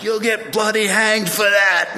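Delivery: high, slow Cockney cabbie shout; Cockney accent. Retold or imitated. Cab driver yelling at American on the statue, loud Cockney accent. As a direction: holler it as an outraged Cockney. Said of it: "he has this deep Cockney accent".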